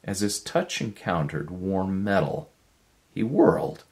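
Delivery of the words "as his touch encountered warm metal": ordinary delivery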